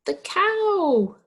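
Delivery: put-on teacher revealing the answer to kids, excited. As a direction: announce the answer like a happy surprise.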